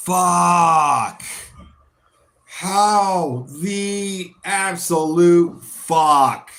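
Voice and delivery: very slow, drawn-out outraged question; stunned disbelief. Real, not acted. Fan asking how Bruno missed, slow stunned disbelief. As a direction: stretch every word in shock.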